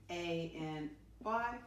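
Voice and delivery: slow letter by letter spelling; spelled out slowly. Shown as a demonstration. Teacher spelling a word for her class, slowly. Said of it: "How do I spell that little word in?".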